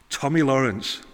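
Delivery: ordinary talk, nothing marked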